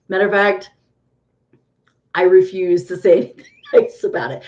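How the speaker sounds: normal talking voice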